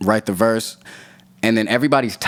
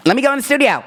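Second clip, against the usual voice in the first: much higher, louder mock voice; imitating Buddy; 3 dB louder, faster than usual. Buddy asking to go in the booth, high mock voice. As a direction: squeak it out like an eager buddy. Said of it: "Buddy just like".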